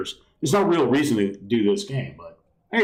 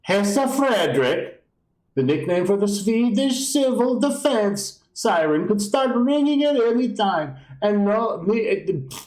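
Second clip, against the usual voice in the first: higher, hammed-up Swedish accent reading; jokey Swedish accent, higher; 8 semitones higher than usual. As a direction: read the pamphlet in a silly Swedish accent. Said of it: "Swedish accent".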